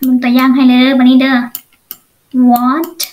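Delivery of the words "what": normal talking voice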